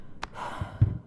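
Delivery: long breathy sigh, fed up; weary sigh. Acted. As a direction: let out a tired, exasperated sigh. Sweaty, restless Lamia complaining.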